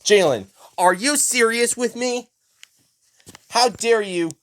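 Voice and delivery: high-pitched, indignant scolding; scolding mom. Acted. Jalen's mom confronts him at home, indignant.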